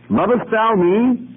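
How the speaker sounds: Lovest thou me? high and slow; voicing Jesus pressing Peter, high and slow. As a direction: ask it high and slowly, pleading. Preacher voicing Jesus' question.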